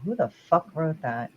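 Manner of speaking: ordinary talk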